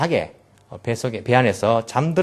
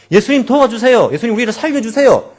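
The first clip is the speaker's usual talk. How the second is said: higher, louder desperate plea; pleading cry; 9 semitones higher, 7 dB louder than usual. Retold or imitated. Disciples crying to Jesus in the storm, desperate plea. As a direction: cry out to be saved. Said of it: "원망 섞인 목소리지만은".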